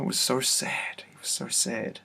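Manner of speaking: barely voiced, choked up with emotion; whispering through real sorrow; sad whisper. Real, not acted. Overwhelmed recalling encounter with Jesus.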